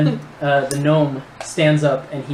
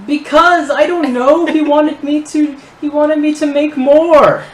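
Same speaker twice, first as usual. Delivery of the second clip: high, loud, stammering plea; panicked gnome; 14 semitones higher, 11 dB louder, slower than usual. Acted. Gnome answering an angry interrogator, panicked. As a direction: blurt it out in fright, pitch way up.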